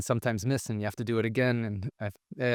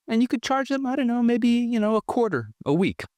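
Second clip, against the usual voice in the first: lightly mimicked dad, voice jumping much higher; dad's pitch; 13 semitones higher than usual. Dad pitching the trash business to his kids, pitched higher. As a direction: sell the idea like a playful dad. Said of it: "And he says".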